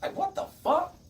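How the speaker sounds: high, loud stunned outburst; like you can't believe your ears; high loud shock; shocked disbelief. Real, not acted. Shocked at accusation.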